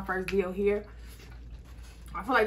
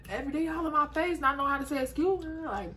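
talking between bites; chewing while speaking; mouth partly full; 7 semitones higher than usual. Real, not acted. Seen 1: Holds food at mouth, speaking between bites; chewing likely but not certain.

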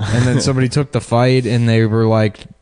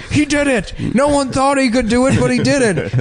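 much higher, ecstatic sportscaster yell; excited announcer; 12 semitones higher than usual. Retold or imitated. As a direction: call it like a championship win. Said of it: "and it's like".

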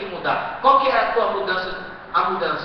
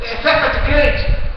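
mock suspicious question; 5 semitones higher, 7 dB louder, slower than usual. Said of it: "Eles vão perguntar assim".

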